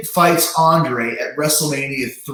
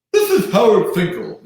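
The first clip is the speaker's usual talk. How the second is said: booming ring announcer voice, pitched up; Howard Finkel impression; 8 semitones higher, 4 dB louder than usual. The announcer's answering machine message, booming announcer voice. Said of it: "I'm doing the worst Howard Finkel".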